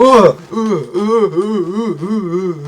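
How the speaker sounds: loud high stammering euh, half laugh, half cry; shocked stammer. Real, not acted. Stunned by the game twist, loud laughing-crying euhs. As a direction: blurt stunned euhs at full volume.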